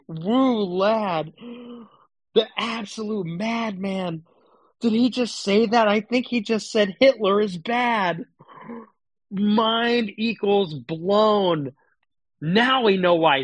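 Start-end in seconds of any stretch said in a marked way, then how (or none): 0.1-11.7 sarcastic hype. Pretend. Sarcastic mock praise.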